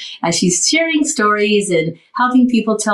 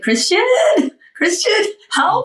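high pleading call. Retold or imitated. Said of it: "I said".

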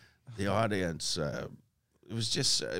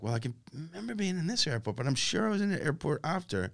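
retold puzzling; 5 semitones higher, 4 dB quieter, faster than usual. Retold puzzling out his location.

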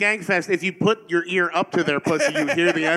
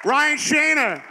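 announcer intro; 7 semitones higher than usual. Acted. Announcing guest entrance.

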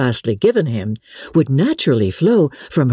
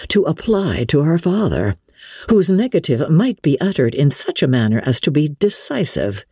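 ordinary delivery

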